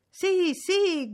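high, loud, eager cheer; excited cry. A narrator's reading. Young guests clamour for the fortune-teller, excited cry. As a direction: cry out like a crowd of young people. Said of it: "gridò tutta la gioventù all'unisono".

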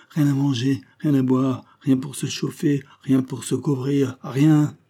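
plaintive sailors, ending on a loud cry; desperate crewmen. Acted. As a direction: hammer out each item of the list. Crew lists all they lack.

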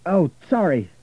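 loud, high, caught off guard; mild startled apology. A narrator's reading. Richard apologising to the driver, mildly startled.

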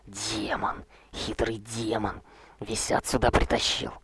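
slow venomous hiss; hissing whisper. Acted. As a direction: hiss it through clenched teeth. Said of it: "прошипел".

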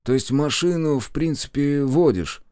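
puzzled. A narrator's reading. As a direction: ask as if working it out. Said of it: "озадаченно молвил он". (озадаченно)

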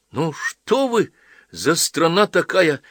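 angry. A narrator's reading. Young man circles the old priest protesting, angry. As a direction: demand it in a high temper. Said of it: "злился Половинкин".

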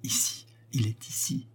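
frightened whisper, barely voiced; breathed whisper. A narrator's reading. Mademoiselle Dulandé whispers to the narrator that the intruder is here, terrified whisper. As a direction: breathe it out in terror, no voice. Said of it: "mademoiselle Dulandé en souffla".

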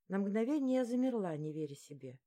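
ordinary delivery

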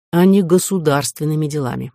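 plain reading voice

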